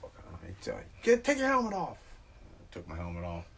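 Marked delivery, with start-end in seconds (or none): 1.0-2.0 high mock voice barking an order; squeaky mock trooper command; mock cop order. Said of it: "cop's order retold".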